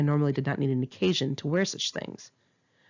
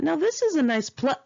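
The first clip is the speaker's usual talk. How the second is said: delighted thought; 10 semitones higher, 3 dB louder than usual. Retold or imitated.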